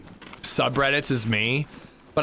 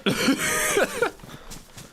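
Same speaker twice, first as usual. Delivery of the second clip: high laugh. Real, not acted. Squeaky laugh after joke.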